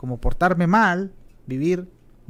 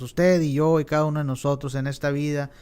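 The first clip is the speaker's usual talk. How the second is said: ordinary talk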